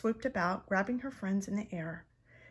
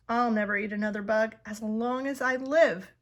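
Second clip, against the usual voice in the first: loud happy vow; cheering; 8 dB louder than usual. A narrator's reading. Stella Luna stuffed with mango, cheering loudly. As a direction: cheer with a full belly. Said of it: "cheered Stella Luna".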